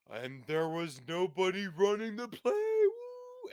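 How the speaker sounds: spooky storyteller ending in a high woo; campfire story voice. Acted. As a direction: tell it like a ghost story round the fire. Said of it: "It's like a campfire story".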